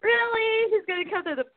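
high-pitched, drawn-out disbelief; incredulous. Retold or imitated. Podcaster mocking a silly soap plot, incredulous. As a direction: stretch it out high, mock-incredulous.

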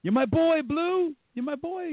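high, excited cheer of a frat guy; high-pitched hammed-up movie quote; movie quote impression. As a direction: belt it out like a proud fraternity buddy. Quoting Old School movie line.

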